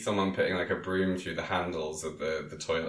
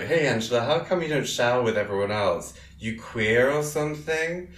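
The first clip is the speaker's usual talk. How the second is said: mock taunting bully; 6 semitones higher, 4 dB louder than usual. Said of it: "And is like".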